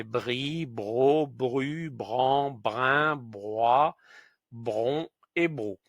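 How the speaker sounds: brie bro bru bran brin broie bron brou each said clearly; syllable drill. Shown as a demonstration. Teacher reading the br syllable chart. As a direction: read out the br syllables one by one. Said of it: "avec un I, ça fait".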